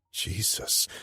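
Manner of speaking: usual speaking voice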